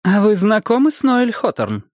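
abrupt, high-pitched detective's question; sharp questioning. Acted. Detective changes subject on the husband, asking sharply. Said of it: "резко спросила Бонни".

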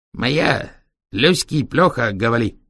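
put-on slow broken Chinese-accented speech. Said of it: "с китайским акцентом". (с китайским акцентом)

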